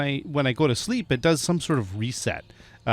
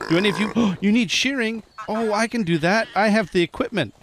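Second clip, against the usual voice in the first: high, eager chatter to the sheep; cooing to animals; 6 semitones higher than usual. Real, not acted. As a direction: offer it like a treat to pets. Coos to the sheep.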